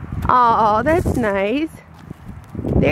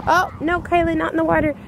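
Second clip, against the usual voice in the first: ordinary talk, nothing marked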